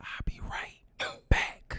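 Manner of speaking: hushed, voiceless whisper. A narrator's reading. Whispering a promise to Eva before leaving, whisper. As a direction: whisper it privately to her. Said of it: "I whisper to Eva".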